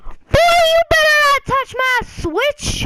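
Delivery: high, loud, drawn-out mock outburst; outraged warning. Slapping away the kid's hand from his Switch, acted outraged yell. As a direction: screech it slowly as a hammed-up threat.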